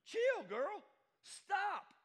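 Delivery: high, loud sassy order; exclamatory command. Acted. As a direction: call out sharply to make her quit. Playful voice of Spirit ordering.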